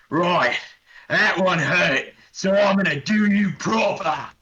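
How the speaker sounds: a wounded orc sneering back through blood; grinning orc. Acted. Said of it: "the orc smiles at this with blood burbling out of his mouth and goes".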